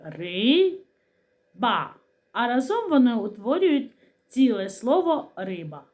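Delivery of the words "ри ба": Ри-ба, long first syllable, then a break. Shown as a demonstration. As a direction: separate Ри from ба clearly. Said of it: "Ці частинки називаються складами".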